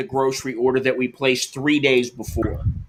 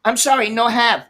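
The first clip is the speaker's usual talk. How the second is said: higher, apologetic broken English; mock clerk voice; 7 semitones higher than usual. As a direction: imitate the clerk saying it's out of stock.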